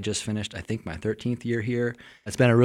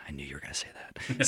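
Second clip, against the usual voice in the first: whispered aside; 6 semitones lower, 9 dB quieter, faster than usual. Retold or imitated. Retold reply to professors.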